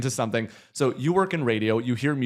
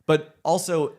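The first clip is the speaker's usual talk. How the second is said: normal talking voice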